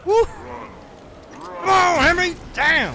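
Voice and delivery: very loud, high triumphant shout; hyped-up yell. Real, not acted. Gamer downing a survivor named Henry, in a very loud hyped yell. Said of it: "I was just hyped up a little bit there".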